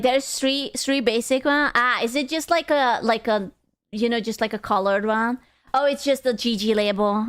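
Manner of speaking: ordinary delivery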